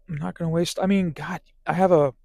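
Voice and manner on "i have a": hiccuping mid-sentence; words cut by a hiccup. Real, not acted. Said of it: "excuse me, I got the hiccups".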